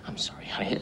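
hushed apology whispered close; soft whispered sorry. Real, not acted. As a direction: whisper it so only she hears. Husband soothing wife privately.

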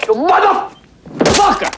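slow high drawn-out curse; enraged yell; furious shout. Real, not acted. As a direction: stretch out the curse at full voice. Answering the insult.

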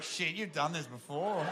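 soft, slow dawning realisation; hushed realisation. Retold or imitated. Boyfriend realises she is experienced, hushed and slow. Taken aback aside at her aggression.

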